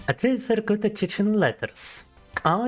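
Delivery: usual speaking voice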